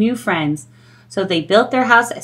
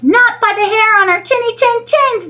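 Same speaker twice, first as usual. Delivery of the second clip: very high squeaky pig answer; defiant little pig; pig reply; 12 semitones higher than usual. Acted. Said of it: "the pig replied".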